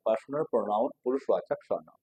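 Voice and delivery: ordinary delivery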